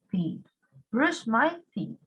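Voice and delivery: ordinary talk, nothing marked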